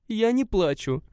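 trying hard not to cry; holding back tears. A narrator's reading. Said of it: "изо всех сил сдерживая слёзы". (сдерживая слёзы)

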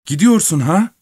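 high shrill cry. A narrator's reading. Young peasant panics as the thief leaves, high shrill cry. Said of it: "Gavrila tiz bir sesle".

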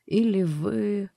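slow, curious eerie question. Acted. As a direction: ask it slowly, intrigued. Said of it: "с любопытством спросил".

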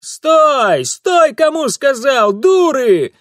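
put-on high, loud bawl after fleeing girls; angry yell. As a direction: bellow at them to stop. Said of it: "заорал вслед Франт". (сердитый ор вслед)

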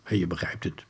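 plain reading voice

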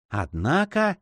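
uneasy, half-voiced protest; hesitant, slightly fearful. A narrator's reading. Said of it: "сказал монах с нерешительностью, не лишённой страха".

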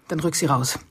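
quiet menace, every word an order; low ultimatum. Acted. Fiancée gives her final cold, low ultimatum. Ultimatum to hand over papers.

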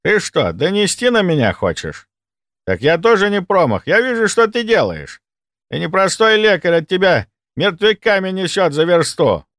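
high-pitched, accusing counterattack; defensive thief turning threatening; cornered, hostile orderly. Acted. Orderly threatens narrator back.

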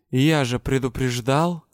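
ordinary delivery

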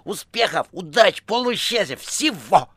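high, loud, gushing farewell; chatterbox phone voice. A narrator's reading. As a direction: pile on good wishes effusively. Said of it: "говорила трубка". (высокий, восторженно-льстивый голос)

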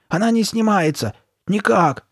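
high, urgent cry of dismay; alarmed exclamation. A narrator's reading. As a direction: exclaim in rising panic at being stuck. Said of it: "воскликнула она".

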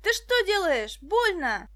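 put-on panicked boy shouting in pain; pained cry. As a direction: yell as the arm is twisted.

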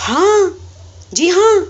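emphatic yes. Acted. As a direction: burst out agreeing.

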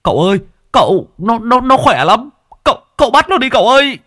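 high, fast panicked pleading; frightened crying out. Acted. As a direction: beg for help in fright. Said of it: "kêu lên".

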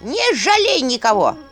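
put-on high, rattling old skull voice; cackling skull voice. A narrator's reading. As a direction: egg her on like a cackling skull. Skull urges her to fight.

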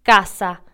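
"casa" with a full, crisp s between the vowels; clear s in casa. Shown as a demonstration. Teaching learners, a clear s in "casa". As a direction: give the s in "casa" its full hiss. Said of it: "Por ejemplo".

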